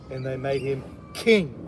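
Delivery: ordinary delivery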